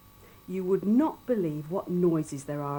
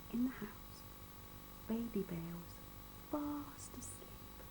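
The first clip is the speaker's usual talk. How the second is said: hushed whisper; 3 semitones higher, 14 dB quieter than usual. A narrator's reading. Storyteller hushing over sleeping Baby Bear.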